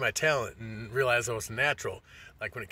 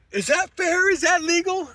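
much higher, whiny mock complaint; mock whiny goalie; 8 dB louder than usual. Mocking the goalie who just got scored on: high whiny voice. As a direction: squeak it out like a sore loser.

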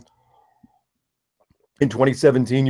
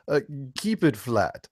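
put-on a light Russian accent sign-off; 5 semitones higher, 3 dB louder than usual. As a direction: close the show in a Russian accent. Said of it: "in your Russian accent".

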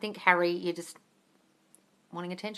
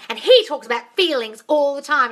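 louder mimicked claim; louder, higher mimicry of Candace; mocking imitation; 5 semitones higher, 8 dB louder than usual. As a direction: parody her insistence. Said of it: "she's going".

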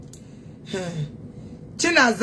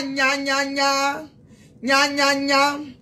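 mocking nya nya; 2 semitones higher, much slower than usual. Real, not acted. Mocking the rival fans with a sing-song nya nya. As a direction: tease like a playground bully. Childish taunting noise.

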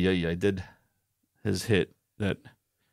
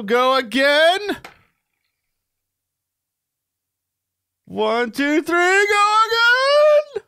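much higher, louder, drawn-out glee; excited count; 6 dB louder, much slower than usual. Real, not acted. Counting spaces to her partner, gleeful and rising. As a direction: sing out the win.

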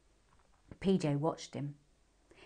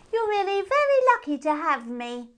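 very high and loud; boastful hare; 11 semitones higher, 8 dB louder than usual. A narrator's reading. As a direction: show off shamelessly.

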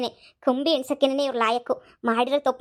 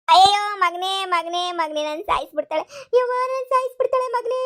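wailing for help; 5 semitones higher than usual. Acted. Beaten, wails to her son.

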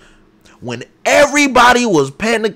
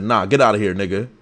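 mock dismissive voice; 11 semitones lower, 5 dB quieter, faster than usual. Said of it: "He said".

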